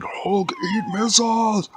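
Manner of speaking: loud, high, slow monster bellow; Hulk. Acted. Hulk defies incoming missiles, slow bellow. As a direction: roar it out like a cartoon brute. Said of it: "And the Hulk was like".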